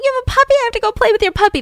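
high, fast gushing squeal; childlike excitement. Retold or imitated. Candy begging Betty to play with the puppy, childlike squeal. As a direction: squeal like a kid who just saw a puppy. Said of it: "Candy's like a kid".

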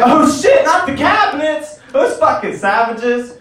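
high, loud mock horror; mock outrage. Comedian mocks the ghost show with hammed-up high outrage. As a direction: ham up the fake shock. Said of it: "It's like".

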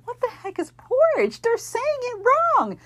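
high, squeaky mock outrage at "porridge"; a little kid scoffing at how others say "porridge"; childish indignation. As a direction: play a kid baffled by a strange word, voice up high. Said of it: "and I'm like".

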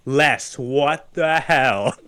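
slow, weighty imitation of a stunned dad; drawn-out disbelief. Dad finding the doll, slow exasperated disbelief. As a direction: stretch each word out in mock disbelief.

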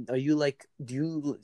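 usual speaking voice

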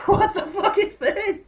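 high, laughing disbelief; stunned Everton fan squeaking through a laugh; shocked outburst. Retold or imitated. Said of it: "I was like".

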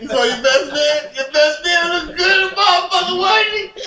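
high, loud, laughing brag; hyping up the groom's best man; teasing hype. Real, not acted.

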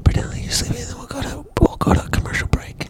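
soft unvoiced whisper. Real, not acted. Whispering to sleepy Brittany about a commercial break. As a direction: hush it so she won't wake. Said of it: "whispering to sleepy Brittany".

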